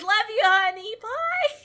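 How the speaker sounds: high, sugary sign-off; bubbly sorority girl. Retold or imitated. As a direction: chirp it like a sweet mom. Voicing cheery mother sign-off.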